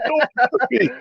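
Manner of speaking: high-pitched imitation of pleading; mock frightened plea. Storyteller as shoplifter cornered by guard, mock terrified plea. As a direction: beg like a cowardly thief, hammed up.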